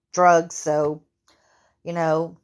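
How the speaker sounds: ordinary talk, nothing marked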